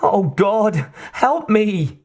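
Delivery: high, despairing plea; weak cry. A narrator's reading. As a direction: plead high, losing strength. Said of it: "Kate cried weakly".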